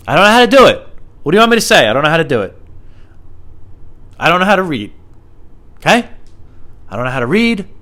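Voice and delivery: high, loud exasperated rant; mock exasperation. Defending his podcast to listeners, mock exasperated high outburst. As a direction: throw up your hands, high and loud.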